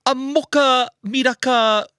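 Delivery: put-on Italian accent mocha order. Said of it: "He turned Italian".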